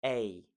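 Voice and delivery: "a" gliding from eh to ih; diphthong A. Shown as a demonstration. Teacher showing the diphthong, "a" as in hey. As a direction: say "a" as in mate, two vowels blended. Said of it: "you have the diphthong A".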